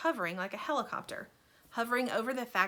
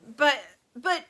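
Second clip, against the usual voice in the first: loud, high panicky stammer; stammering shout; 9 semitones higher, 12 dB louder, slower than usual. A narrator's reading. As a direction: sputter in panic. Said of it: "Grandpa Joe shouted".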